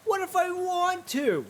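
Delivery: high, slow, whining echo of the question; whiny mock repeat. Talking back to the game in a high, slow whine. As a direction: pout it out like a sulky kid.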